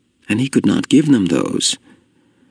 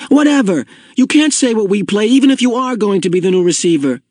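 high-pitched indignant outburst; angry retort; 12 semitones higher than usual. A narrator's reading. Boy arguing with the new receiver, angrily. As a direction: brush off the correction and argue back.

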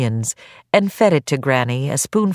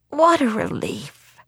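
put-on frail old woman voice; 10 semitones higher than usual. Granny voiced.

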